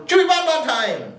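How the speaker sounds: high, gleeful war whoop; dinosaur yell. A narrator's reading. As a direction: holler it high like a hungry pack. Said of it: "three dinosaurs yelling".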